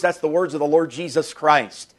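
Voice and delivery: normal talking voice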